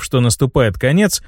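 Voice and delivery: plain reading voice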